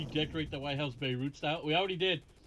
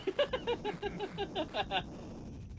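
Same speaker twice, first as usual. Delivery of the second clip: high-pitched laugh. Real, not acted. Laughs at own mumbling.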